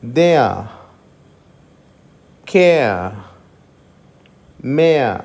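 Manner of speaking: దే ఆ with the r dropped; no final r. Shown as a demonstration. Teacher models words without the final r. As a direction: end on a clear ఆ with no r. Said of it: "చివర్లో ఉన్నటువంటి ఆర్ని మనం పలకడం జరగదు".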